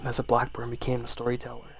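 usual speaking voice

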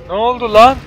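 alarmed shout. Real, not acted. Player caught off guard by an attacker, loud outcry. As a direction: yell in sudden alarm. Zombie attacks him suddenly.

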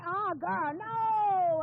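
put-on loud high "oh girl, no"; excited exclamation. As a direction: squeal it out, teasing. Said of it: "ran up to me and was like".

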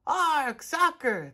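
the whole crew squawks it back; crew yell. A narrator's reading. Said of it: "the crew yelled".

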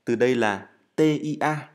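ordinary delivery

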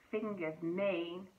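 slow suspenseful drawl; teasing build-up. Acted. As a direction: draw it out, hold them waiting.

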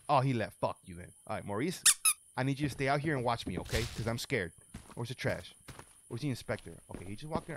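hushed and whispery, nervous; scared hush. Real, not acted. Hushing to Maurice, scared of being caught: nervous whisper. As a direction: keep it down so he won't hear.

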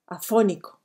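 ordinary talk

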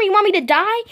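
ordinary talk

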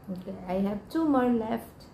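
talking between bites; chewing while talking. Real, not acted. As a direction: mouth partly full of apple. Seen 0: Lips move and cheek bulges while she scoops apple pieces.

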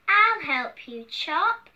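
loud, stretched-out words; hearty king's offer. Acted. Hungry king offers to chop for the cook, loud and emphatic. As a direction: boom it slowly, rising at the end.

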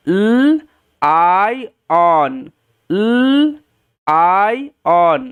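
"ল, আই, অন" slowly, sound by sound, held l first. Shown as a demonstration. Teacher reading lion by sounds for learners, sounded out slowly. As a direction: stretch each part of "ল, আই, অন" with gaps between. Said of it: "sound করে পড়াটা new fashion".

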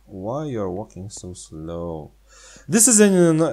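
ordinary talk

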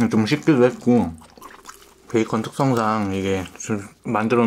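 speaking between burger bites; talking while chewing. Real, not acted. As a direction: mouth partly full of food.

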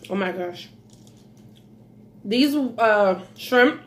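ordinary talk

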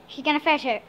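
usual speaking voice

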